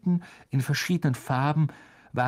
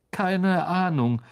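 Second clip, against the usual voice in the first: evasive lie; 7 semitones higher, 3 dB louder, slower than usual. A narrator's reading. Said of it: "log Harry".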